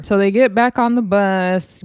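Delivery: ordinary delivery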